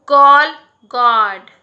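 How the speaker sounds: गॉल, गॉड with a long open aw sound; slow drawn-out vowel. Shown as a demonstration. Teacher demonstrating गॉल, गॉड with a slow open aw. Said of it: "हम गॉल कहते हैं".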